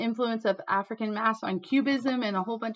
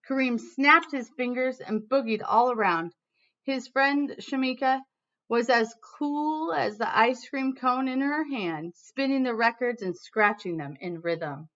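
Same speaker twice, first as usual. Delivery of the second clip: lively read-aloud storytelling voice; reading to children; 3 semitones higher, 5 dB louder than usual. Acted. Teacher reading a picture book to her class, lively storytelling.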